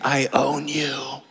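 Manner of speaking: slow, lightly voiced smug cat; a hint of a gloating cat; smug cat's thoughts. Cat in the window taunting the allergic owner, slow and smug.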